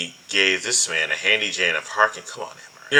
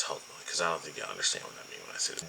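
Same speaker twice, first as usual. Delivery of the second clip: hushed aside; 3 semitones lower, 13 dB quieter, faster than usual. Real, not acted.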